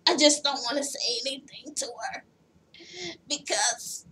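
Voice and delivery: imitating a husky smoker, fading out; Big Ange. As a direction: let the mock smoker's voice sink into a rough whisper. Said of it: "I'll fuck up my throat".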